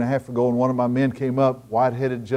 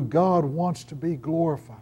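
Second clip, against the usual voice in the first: ordinary delivery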